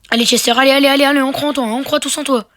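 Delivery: loud encouraging shouts to a teammate; rally your teammate at full voice; cheering. Real, not acted. Cheering teammate during game. (encourage loudly your teammate Chester)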